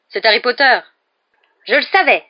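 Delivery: loud, high-pitched delight; delighted cry. A narrator's reading. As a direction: cry it out in triumph. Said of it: "s'écria Stan d'un air ravi".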